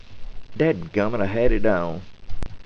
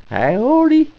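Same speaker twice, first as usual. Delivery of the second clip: high, loud hillbilly "Howdy"; Minnie Pearl; 6 dB louder than usual. Retold or imitated. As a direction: Minnie Pearl's big country greeting. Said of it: "that was Minnie Pearl".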